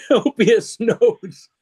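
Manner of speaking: Copious Notes drawn out high and slow through a laugh; delighted, laughing echo of the name; laughing repeat. Real, not acted. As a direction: savour Copious Notes while cracking up. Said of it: "That's a great name for a jazz musician".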